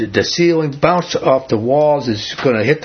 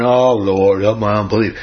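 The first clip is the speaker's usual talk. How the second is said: lower, bored, as if yawning through the words; yawned prayer; 5 semitones lower than usual. Retold or imitated. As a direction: say it like you can barely be bothered. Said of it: "this guy didn't yawn".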